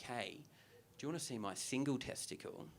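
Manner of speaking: hushed, confidential offer; furtive proposition. Acted. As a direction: ask it very softly, like a secret. Same imagined offer at a party.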